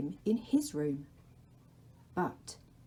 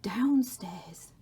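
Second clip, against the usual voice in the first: the Dark's whisper; 2 semitones higher, 3 dB louder than usual. Acted. The Dark calls to Laszlo in a whisper. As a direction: breathe his name from the shadows.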